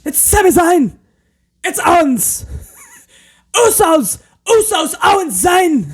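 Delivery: very high shouted names in spurts; mock a frantic announcer blurting out names; Kevin Patrick. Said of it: "the way that he yells is in, like, spurts".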